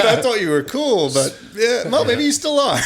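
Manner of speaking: high, loud, laughing through the words; laughing mock disappointment. Teasing the bassist he thought was cool, laughing. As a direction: crack up while retelling the letdown.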